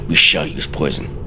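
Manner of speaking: plain reading voice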